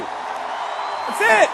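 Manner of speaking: high, loud shouted agreement; emphatic approving yell; excited shout. Real, not acted. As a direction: shout it back to the room. Affirming crowd response.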